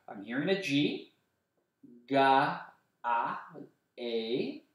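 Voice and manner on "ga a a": Ga split into slow separate sounds; sounding out a word for kids. Shown as a demonstration. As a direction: stretch Ga, a, a apart like a teacher. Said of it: "that sounds like a Z to me".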